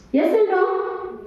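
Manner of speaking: ordinary talk, nothing marked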